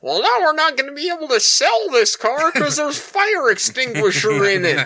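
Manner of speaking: very high, put-on complaining voice; mock a fretting coworker in a squeaky high pitch; hammed-up high-pitched impression; Larry. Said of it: "He was like".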